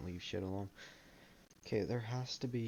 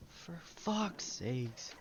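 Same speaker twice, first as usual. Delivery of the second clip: exasperated; 6 semitones higher, much slower than usual. Real, not acted. Game rendering keeps failing.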